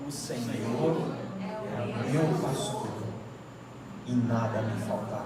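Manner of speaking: very slow, reverent psalm reading; slow scripture recitation. Acted. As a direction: recite it slowly and solemnly.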